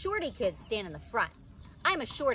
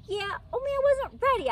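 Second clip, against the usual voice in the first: high, loud, stamping complaint; stamping protest; 5 dB louder than usual. A narrator's reading. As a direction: demand another turn. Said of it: "I stamped my foot".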